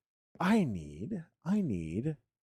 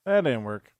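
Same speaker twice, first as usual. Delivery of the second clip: said again, louder, with a falling finish; louder, firm restatement; louder repeat; 3 semitones higher, 5 dB louder than usual. Real, not acted. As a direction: repeat it out loud so everyone hears. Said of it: "Oh, that didn't work".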